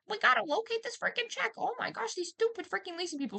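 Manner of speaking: high-pitched exasperated rant; frantic panic. Retold or imitated. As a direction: freak out over a lost check. Frantic self-quote to leasing office.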